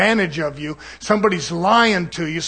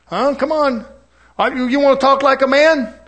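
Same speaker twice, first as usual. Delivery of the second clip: mock challenge; 6 semitones higher than usual. Angry man picking a fight.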